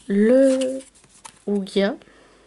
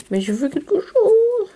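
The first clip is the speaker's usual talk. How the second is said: pleading wish; 10 semitones higher, 5 dB louder than usual. Real, not acted. Pleading for a good pull.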